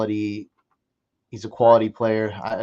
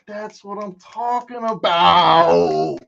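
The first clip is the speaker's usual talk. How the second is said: slow, louder, stretched-out gloat; higher, loud, slow victory call; triumphant drawl; 7 semitones higher, 7 dB louder, slower than usual. Real, not acted. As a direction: savor every word of the win. Drawn-out triumphant repeat.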